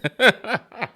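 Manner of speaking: high-pitched burst of real laughter; hearty laugh. Real, not acted. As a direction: crack up at your own retelling. Cracks up at funniest part.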